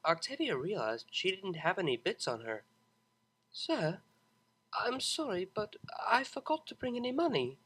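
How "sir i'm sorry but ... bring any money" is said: guilty apology. Acted. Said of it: "she said guiltily".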